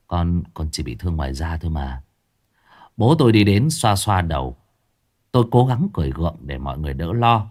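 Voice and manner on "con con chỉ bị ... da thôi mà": faint, low and much softer, barely there; weak murmur. A narrator's reading. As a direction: breathe it out, too weak to talk. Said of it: "Tôi mới thều thào".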